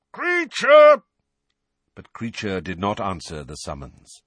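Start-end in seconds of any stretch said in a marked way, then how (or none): 0.1-1.0 much louder, higher full bellow of the name. A narrator's reading. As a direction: bellow for the elf at the top of your lungs. Calling the elf twice.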